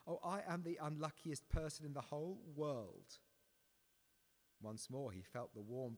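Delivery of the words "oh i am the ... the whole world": high, loud, panicked pleading; frightened boy's half scream; almost a scream. Acted. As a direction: beg the thing to go away in terror. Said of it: "he said almost in a scream".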